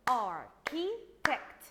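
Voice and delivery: "Architect" in three loud, slow, separate beats; clapped-out syllables. Shown as a demonstration. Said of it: "Let's clap the word together".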